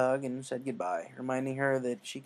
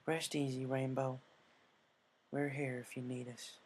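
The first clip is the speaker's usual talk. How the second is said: quiet wish; 7 dB quieter than usual. Acted. Applejack's quiet wish to Rainbow.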